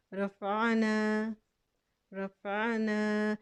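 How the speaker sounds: रफ़ाना slowly, the final "aa" kept; recited word. Shown as a demonstration. Teacher recites the word slowly. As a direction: hold the long aa of रफ़ाना. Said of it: "अलिफ मद्दा ऐसे ही पढ़ेंगे".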